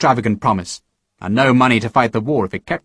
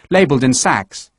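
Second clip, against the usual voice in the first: usual speaking voice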